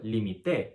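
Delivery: límite stressed as li-mi-TE; final-syllable stress. Shown as a demonstration. Teacher giving the past form, límite as li-mi-TE. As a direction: land on TE at the end of límite. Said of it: "first person singular past indicative".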